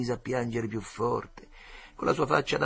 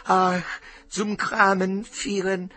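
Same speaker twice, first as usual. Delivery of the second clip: high, tearful made-up German; crying boy forced to speak German; louder crying fake German; 10 semitones higher, 5 dB louder, much slower than usual. A narrator's reading. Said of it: "ripeté un poco più forte il Piattoletta".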